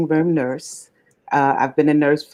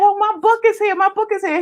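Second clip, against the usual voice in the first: much higher, giddy squeal of joy; retold excitement; faster than usual. To the mailman about her arrived book, high giddy excitement. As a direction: replay her thrill at the package. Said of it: "when I said".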